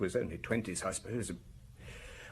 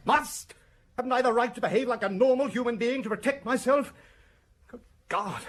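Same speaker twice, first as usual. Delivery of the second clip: "Must?" flung back much higher and louder, then a furious protest; outraged shout; 13 semitones higher, 4 dB louder than usual. Acted. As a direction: explode at being told to calm down. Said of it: "you must calm down".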